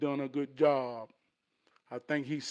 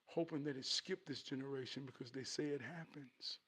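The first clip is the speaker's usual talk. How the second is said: soft, lightly voiced dad; explaining father; 11 dB quieter than usual. Father explaining the family burden to son, soft and grave. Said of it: "He said".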